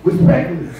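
low, lightly put-on New York street voice; a hint of a Brooklyn guy saying yo, respectfully; New York accent. Imitating the Vegas guy on stage, lightly New York. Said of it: "gets up on stage every night and goes".

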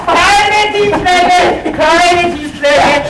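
slow drawn-out warning. Acted. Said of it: "referee drawn-out warning".

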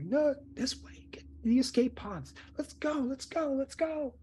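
very high, urgent crowd voice; acted high-pitched panic; panicked crew member. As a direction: hurry everyone along in a high, strained pitch. Crew urging others to escape pods.

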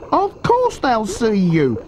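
high, slow little-croc voice; high-pitched, measured taunt; scornful small crocodile. A narrator's reading. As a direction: rub it in, high and drawn-out.

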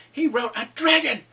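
high, shouted mock praise; loud boasting. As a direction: proclaim the feat at full volume. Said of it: "he is boasting him".

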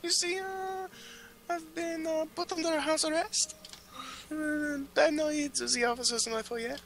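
high, fussy aristocrat voice; high posh gentleman voice. Acted. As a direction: play a prim nobleman, voice up high. High posh character voice held.

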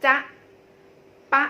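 จ๊ะ and ป๊ะ as short, cut-off syllables; isolated short vowels in จ๊ะ and ป๊ะ; short single syllables. Shown as a demonstration. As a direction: say จ๊ะ, ป๊ะ briefly, each on its own. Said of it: "เป็นพยางค์เดียวได้ที่สั้นๆ".

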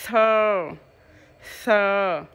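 "Third," with a blown th and a long er sound; model "third". Shown as a demonstration. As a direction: join the th into the er of "third.". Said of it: "con chưa nối âm ơ vào". (đọc mẫu "third." nối âm ơ)

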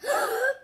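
high startled gasp; mock gasp. High mock gasp, reacting to the book for kids. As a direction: gasp in big pretend shock. Surprise at story turn.

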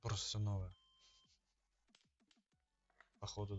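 normal talking voice

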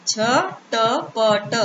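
very slow, झटपट pulled apart as झ-ट-प-ट; split into syllables. Shown as a demonstration. Teacher sounding out झटपट slowly for children. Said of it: "अब एक साथ मिला के पढ़ते हैं".

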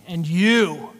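put-on loud, drunken challenge; lightly acted drunken call-out; mock drunk. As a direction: imitate a drunk singling someone out. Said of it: "he's still drunk. He's like".

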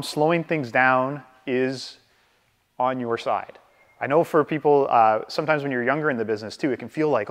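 ordinary talk, nothing marked

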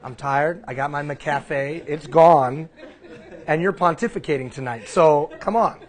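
laughing, sassy grumble; lightly voiced tired friend, laughing through it; mock sass. Scene: Sonia mock-refusing to play, laughing sass. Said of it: "Sonia looked at me like".

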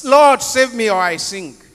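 high, loud, drawn-out plea; a desperate shout to the Lord; cry for help. Retold or imitated. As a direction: cry out as if sinking. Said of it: "when he cried to Jesus".

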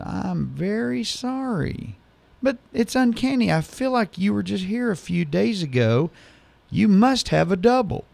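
high, slow overly polite voice; apologetic bartender. Retold or imitated. Fooled bartender apologizes to the customer, high and overly polite. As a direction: sweet-talk him with exaggerated politeness.

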